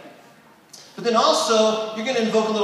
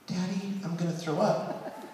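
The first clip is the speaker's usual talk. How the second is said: put-on softer, sweet child voice; soft little girl; 4 semitones lower, 10 dB quieter than usual. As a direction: say it softly like a small daughter. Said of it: "very softly say".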